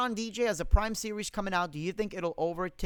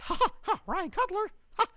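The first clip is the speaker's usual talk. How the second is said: very high squeaky cartoon mouse with staccato laughs; Mickey Mouse; 14 semitones higher, 4 dB louder than usual. Streamer saying a viewer's name on request, cartoon mouse voice. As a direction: pitch it way up into a cartoon falsetto. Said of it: "In Mickey Mouse voice".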